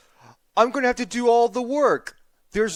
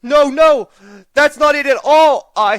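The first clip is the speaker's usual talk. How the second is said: higher and much louder, rushing to deny; panicked denial; 7 semitones higher, 10 dB louder than usual. Acted. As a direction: blurt it out in alarm. Panicked denial to offended yak.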